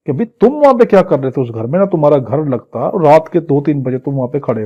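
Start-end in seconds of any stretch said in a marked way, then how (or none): none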